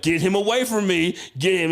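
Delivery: high, loud retold outburst; worked-up comic exasperation; fed-up demand. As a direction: order someone to haul him off. Retold angry plea to brother.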